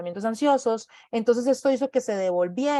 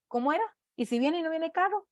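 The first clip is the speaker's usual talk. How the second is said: put-on higher, softer, unsure child's voice; a child's worry; 7 semitones higher, 4 dB quieter than usual. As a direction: ask it like a nervous little kid. Child's worry crossing the street. (voz de niño preocupado, más aguda y suave)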